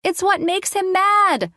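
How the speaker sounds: put-on high child's voice; little girl. A narrator's reading. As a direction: explain the game like a small kid. Addison explains the game.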